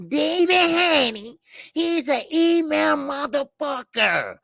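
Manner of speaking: mocking high-pitched little-girl imitation; slow, high mock girly voice. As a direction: mimic him in a high, drawn-out whine. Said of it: "You sound like that little girl".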